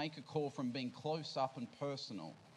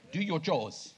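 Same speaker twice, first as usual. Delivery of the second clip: loud, bossy parent barking an order; parent's order; 3 semitones higher, 8 dB louder, slower than usual. Retold or imitated. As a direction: boom it out like a strict dad giving chores. Dad's voice retold to kids.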